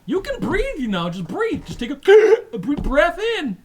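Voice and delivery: high, loud, urging; coaching alien. Acted. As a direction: teach the human to breathe. Guard coaches human to breathe.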